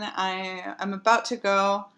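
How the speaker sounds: usual speaking voice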